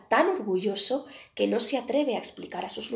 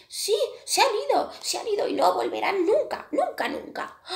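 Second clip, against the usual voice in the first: much higher, louder crow voice; the crow assures the little bird the bullies are gone for good, higher and louder; crow reassuring; 12 semitones higher, 6 dB louder than usual. A narrator's reading. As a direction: promise it gladly as the crow.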